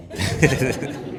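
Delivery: laughing through emotion; choked-up laughter; emotional laugh. Real, not acted. Father moved, laughing.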